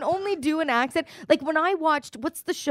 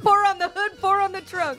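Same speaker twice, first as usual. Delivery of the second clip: higher, laughing gangster recap; mock mob boss; 7 semitones higher than usual. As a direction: relish the job like a hammed-up mobster. Gleeful mob-style recap.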